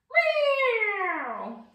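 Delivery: high mock meow; cat meow. Shown as a demonstration. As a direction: mew like a cat for the kids. Teacher meows as cat.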